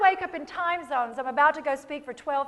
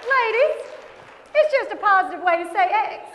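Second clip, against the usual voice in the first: higher, amused and teasing; playful laughing aside; 6 semitones higher than usual. Real, not acted. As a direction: share the joke with a laugh.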